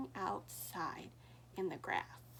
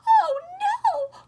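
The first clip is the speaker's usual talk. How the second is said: much higher, louder scared goat cry; frightened cry; 17 dB louder than usual. Acted. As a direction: yelp it in fright. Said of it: "cried the billy goat".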